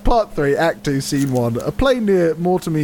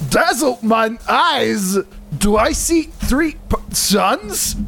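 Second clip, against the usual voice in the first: high, slow Scottish ogre; hammed-up Scottish recitation; Scottish Shrek; 5 semitones higher, slower than usual. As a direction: declaim verse in a badly faked Scottish accent. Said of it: "trying to do the Scottish Shrek thing".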